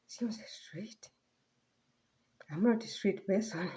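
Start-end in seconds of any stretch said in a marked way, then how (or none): 0.1-1.1 unvoiced, bewildered whisper; puzzled whisper. Repeating the stranger's odd phrase, whispered and baffled. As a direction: breathe the question in disbelief.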